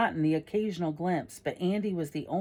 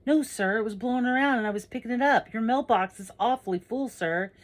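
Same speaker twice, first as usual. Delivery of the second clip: high, hurried excuse; anxious-to-please kid, voice pushed up; protesting boy; 7 semitones higher, 5 dB louder than usual. Acted. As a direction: explain yourself fast to an angry grown-up. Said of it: "So I go".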